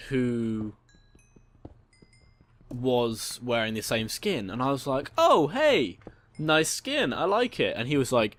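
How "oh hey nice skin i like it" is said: bright, high, cheery hello; friendly greeting. Retold or imitated. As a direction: greet him like a new friend. Friendly greeting to skin twin.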